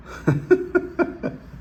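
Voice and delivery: breathy high incredulous laugh; scoff-laugh at the news. Real, not acted. Host laughs off the news about Harris, incredulous high laugh.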